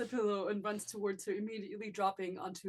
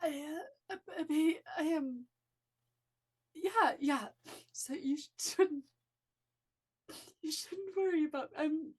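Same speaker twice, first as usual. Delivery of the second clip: higher, halting, voice cracking; emotional, near tears; 7 semitones higher than usual. Acted. Felix reassuring his sister through tears, higher and halting. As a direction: hold back tears while insisting you're fine. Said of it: "I'm so emotional".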